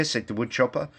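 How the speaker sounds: ordinary delivery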